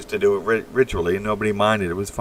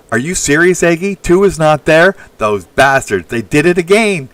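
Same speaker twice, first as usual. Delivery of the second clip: much louder and higher, indignant; loud, outraged disbelief; exasperated outburst; 8 semitones higher, 9 dB louder than usual. Real, not acted. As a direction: blow up at the censors. His test comment got deleted.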